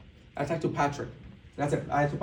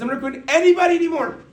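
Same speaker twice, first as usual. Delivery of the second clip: much higher, louder fed-up shout; exasperated shout; 12 semitones higher, 10 dB louder, faster than usual. Retold or imitated. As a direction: slam the door on recruiting. Fed-up outburst about quitting team.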